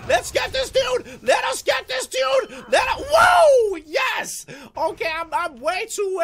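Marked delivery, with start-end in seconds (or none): 0.1-4.5 high, loud, slow battle cries; overexcited yelling. Real, not acted. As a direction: whip yourself into a frenzy, drawing out each cry. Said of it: "way too excited about this game".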